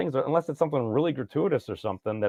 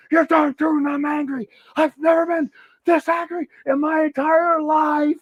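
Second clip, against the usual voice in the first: furious Ren shout; 13 semitones higher than usual. Acted. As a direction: blow up like an enraged cartoon dog. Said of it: "It's like".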